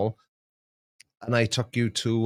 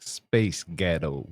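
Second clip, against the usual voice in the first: American accent; 7 semitones lower, 4 dB quieter, much slower than usual. Shown as a demonstration. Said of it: "gonna say in an American".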